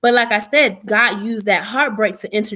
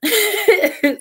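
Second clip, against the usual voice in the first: bright high laugh after the story; 10 semitones higher than usual. Real, not acted. Laughing at her own family story, high laugh. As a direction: let out a real amused laugh.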